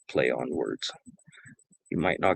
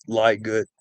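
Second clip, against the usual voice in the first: ordinary delivery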